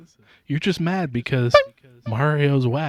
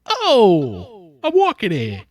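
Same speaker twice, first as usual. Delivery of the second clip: higher mock Brooklyn yell; Brooklyn accent; 8 semitones higher, 5 dB louder, slower than usual. Cohost doing a Brooklyn Italian shout. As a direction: Brooklyn guy stepping into traffic.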